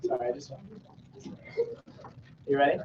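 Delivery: ordinary talk, nothing marked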